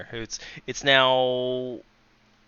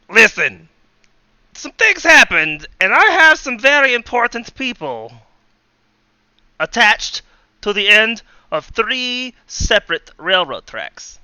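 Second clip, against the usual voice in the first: loud, high, deliberate villain voice; hammed-up Russian radio villain; villain over the radio, Russian accent; 9 semitones higher, 7 dB louder than usual. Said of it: "over the radio comes through, uh, Quote's voices".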